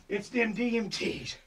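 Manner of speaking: ordinary delivery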